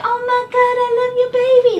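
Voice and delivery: put-on high, squealing baby-gusher voice; mock the stranger cooing over the baby, way up high; hammed-up gushing stranger squealing at a baby. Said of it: "there's people just like".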